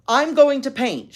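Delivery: put-on high, loud little-girl announcement; announcing. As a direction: declare it like a stubborn child. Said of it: "she announced".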